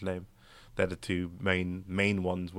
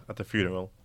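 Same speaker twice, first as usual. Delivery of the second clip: normal talking voice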